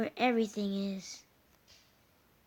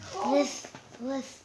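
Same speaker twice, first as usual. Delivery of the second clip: snake hissing; 2 semitones higher than usual. Pretend. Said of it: "can you stop saying slith".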